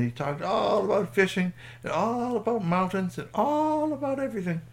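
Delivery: "all" stretched long and high each time; sing-song list with a long, high "all". A narrator's reading. Narrator mimics endless enthusiastic chatting.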